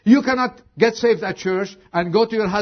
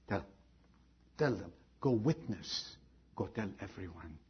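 soft urging; 9 semitones lower, 16 dB quieter than usual. Acted. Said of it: "quiet repeat".

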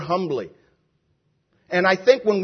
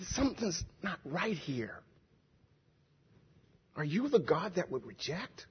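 very soft, low, hesitant questioning; humble questioning; 3 semitones lower, 16 dB quieter than usual. Retold or imitated. Preacher as the psalmist questioning God, hushed and humble. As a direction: barely voice it, with long pauses. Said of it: "I think he asked like that".

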